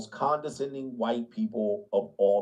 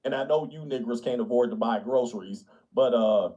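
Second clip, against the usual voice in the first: lower, faux-sincere pandering; lightly voiced smug suburban liberal; hint of patronizing sympathy; mock liberal; 5 semitones lower than usual.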